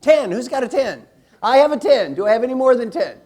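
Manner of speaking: high, loud contest-host call; calling out bids. Real, not acted. Coach hunts the room for the top score, loud calling out. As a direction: shout it out like taking bids. Calls for higher counts from room.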